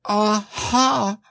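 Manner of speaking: high little-creature aha; anxious Piglet. A narrator's reading. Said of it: "looking round anxiously for the others".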